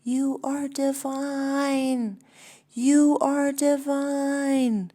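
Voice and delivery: slow, high, booming call; big high divine proclamation; God yelling from a mountaintop. Acted. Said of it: "God stands on a mountaintop and yells".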